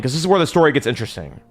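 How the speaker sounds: ordinary talk, nothing marked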